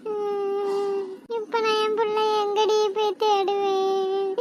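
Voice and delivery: soft, slow weeping lament; a mother mourning her drowned child; tearful quiet wailing; grieving mother. Acted.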